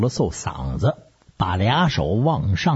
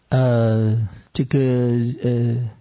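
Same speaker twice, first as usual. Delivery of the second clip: drawn-out, faltering hems and haws; stall, unsure how to begin; halting false start with long fillers; hesitant; slower than usual. A narrator's reading.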